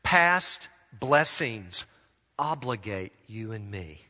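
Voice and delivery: slow right down, pausing between phrases; slow, deliberate. Real, not acted. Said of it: "let me say it slowly".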